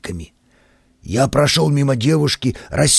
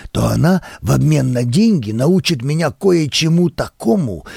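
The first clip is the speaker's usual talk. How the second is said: plain reading voice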